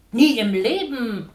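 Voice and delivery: loud, slow protest; a mother loudly refusing, slow; calling out. Acted. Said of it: "ruft Mama".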